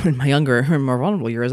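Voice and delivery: ordinary delivery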